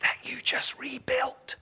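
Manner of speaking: slow sarcastic whisper; slow whispered retort; God's whispered aside. Acted. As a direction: whisper it like an eye-roll from God. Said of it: "imagine God going".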